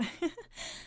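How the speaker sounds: high little embarrassed giggle; nervous giggle. Acted. Mother giggling nervously after saying too much. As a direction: laugh off the awkward moment. Flustered after silly thought.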